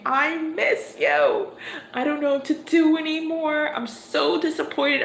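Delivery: pleading to your person. Pretend. Tarot reader voicing the querent to their person, aching plea. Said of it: "going to your person and saying".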